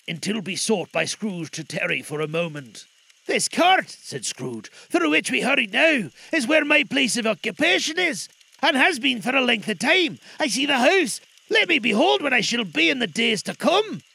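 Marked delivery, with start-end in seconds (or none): 3.2-3.9 high, loud, pleading character voice; anxious Scrooge. Acted. Scrooge begging the spirit for an answer, anxious. 4.9-14.0 high, loud, pleading character voice; anxious Scrooge. Acted. Scrooge begging the spirit for an answer, anxious.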